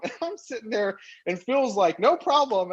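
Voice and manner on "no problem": higher, chirpy yes-man voice; mock eager yes. Phil agreeing to the worldwide deal, high and chirpy. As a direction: imitate a partner overpromising brightly.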